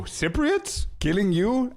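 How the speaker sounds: high, disbelieving question; incredulous. Real, not acted. Vlogger scoffing at fears of Cypriots being dangerous. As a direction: scoff at the very idea.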